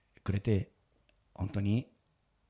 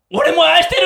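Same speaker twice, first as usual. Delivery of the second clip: high, very loud answering shout; imitating a son's full-voiced shout; shouted reply; 10 dB louder than usual. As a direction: yell the declaration back across the hall. Son shouts back his love.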